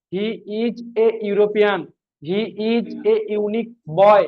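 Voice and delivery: very slow, each word laid out clearly; slow example sentence. Shown as a demonstration. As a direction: dictate it slowly for students to copy. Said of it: "বলা হলো". (ধীরে ধীরে উদাহরণ বাক্য পড়া)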